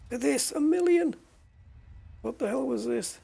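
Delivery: sudden high-pitched amazement; surprised. Real, not acted. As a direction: jump up in delight at the price. Sees a big price.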